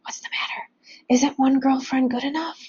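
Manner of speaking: teasing whisper in his son's ear; sly whispered tease. A narrator's reading. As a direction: whisper it close, half the words just breath. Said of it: "then whispers to me".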